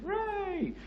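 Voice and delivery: sarcastic high hooray; fake-celebrate the bad news; sarcastic squeaky cheer; mock cheer.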